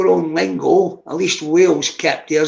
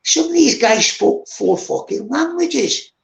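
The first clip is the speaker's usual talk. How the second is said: amazed; 7 semitones higher than usual. Real, not acted. Marvelling at multilingual Iraqi colleagues.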